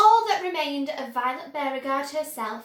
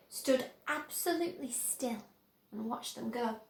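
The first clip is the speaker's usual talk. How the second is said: ordinary delivery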